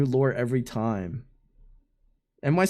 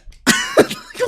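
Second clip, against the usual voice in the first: very high, loud burst of laughter; laughing; 6 dB louder than usual. Real, not acted. Streamer cracking up at the puncher's apology, laughing.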